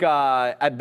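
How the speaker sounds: ordinary talk, nothing marked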